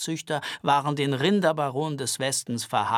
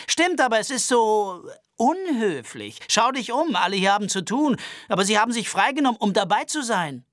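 put-on higher, anxious, aggrieved young man; high-pitched nervous Albert voice; 7 semitones higher, 5 dB louder than usual. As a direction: complain fussily and fast. Albert scolds the gunslinger.

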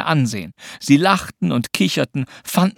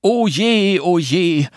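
put-on boy's droning woe, pitched up; grumbled mock lament; 6 semitones higher, 4 dB louder, much slower than usual. A narrator's reading. Anton does her the favour, grumbling in mock woe. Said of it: "brummte er".

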